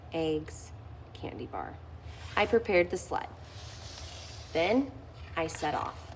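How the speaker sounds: ordinary delivery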